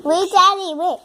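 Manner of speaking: loud, high call. Real, not acted. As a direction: call out to stop him. Calling father.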